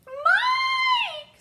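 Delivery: very high, very loud scream; mother's scream. A narrator's reading. Mother screaming after her running son, very high. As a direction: scream her son's name. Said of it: "screamed Missus T V".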